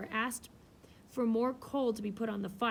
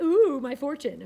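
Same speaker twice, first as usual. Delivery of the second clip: drawn-out, louder swooning ooh; excited ooh; 5 semitones higher, 11 dB louder, slower than usual. Retold or imitated. Ladies at a party gushing over a fortune teller, slow thrilled ooh. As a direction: gush over it like a giddy lady. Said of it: "They're like".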